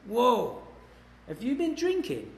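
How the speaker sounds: "drinking" shot up high in mock shock; high mock-astonished policeman; surprised cop. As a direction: play the startled cop, pitch leaping up. Cop smells him, reacts.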